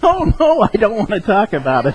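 very high, hammed-up wail, easing down; mock crying. Wife running out after the argument, mock-tearful imitation. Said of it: "she starts crying".

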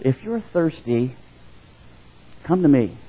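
gentle invitation. Acted. Jesus invites, gentle contrast.

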